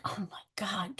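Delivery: breathy voiceless whisper of annoyance; exasperated whisper. Retold or imitated. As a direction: whisper it under your breath, fed up. Annoyed man on quiet subway.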